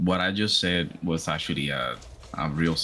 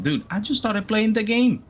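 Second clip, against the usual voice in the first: frantic quote; 14 semitones higher, 4 dB louder than usual. Retold or imitated. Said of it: "I'm like".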